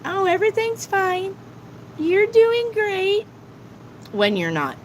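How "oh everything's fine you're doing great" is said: high, sweet, fake-reassuring voice; mock sugary reader. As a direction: parody a reader who only flatters. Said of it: "a reader that tells you".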